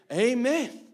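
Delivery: amen, thrown out high and loud. Retold or imitated. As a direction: cheer the girl on with a big amen. Preacher affirming the girl.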